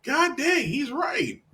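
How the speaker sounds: high squeaky mock outrage; high mock exclaim. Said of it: "I'm like".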